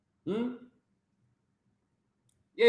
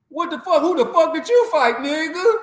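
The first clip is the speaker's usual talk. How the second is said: exasperated shout; 8 semitones higher, 4 dB louder than usual. Real, not acted. Ranting at a boxer.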